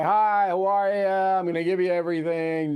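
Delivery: a hint of a grinning glad-hander; smiling campaigner. Host mimics the cheerful candidate greeting voters, lightly mocking. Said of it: "Smiling guy".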